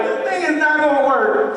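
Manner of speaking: high, loud exasperated thought. Acted. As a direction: cry out in mock dismay. Exasperated thought on a bad date.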